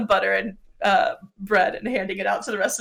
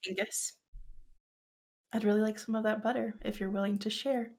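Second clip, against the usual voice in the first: soft request; 13 dB quieter, faster than usual. Acted. Leon softly asks for butter.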